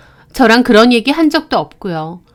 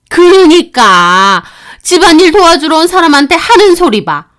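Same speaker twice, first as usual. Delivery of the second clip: louder and higher, lecturing with contempt; angry mother-in-law; 4 semitones higher, 5 dB louder than usual. Acted. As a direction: scold her for forgetting her place. Mother-in-law yelling at daughter-in-law. (며느리를 꾸짖는 화난 시어머니)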